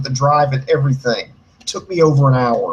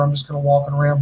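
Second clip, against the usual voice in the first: plain reading voice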